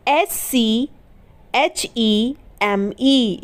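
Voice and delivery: very slow letter-by-letter spelling; spelling slowly. Shown as a demonstration. Teacher finishing the spelling of scheme slowly. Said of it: "इसे हम क्या पढ़ते हैं?".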